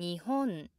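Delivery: 日本 rises on ほ and drops at once on ん; rise, immediate downstep. Shown as a demonstration. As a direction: peak on ほ in 日本, then step straight down. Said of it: "it immediately goes down".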